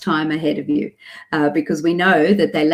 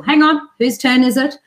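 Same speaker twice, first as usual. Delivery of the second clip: higher-pitched interrupting parent voice; refereeing parent; 7 semitones higher, 4 dB louder than usual. Retold or imitated. Parent directing talk at the dinner table, refereeing. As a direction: cut in to sort out turns, pitch up. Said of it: "we have to do it at the dinner table".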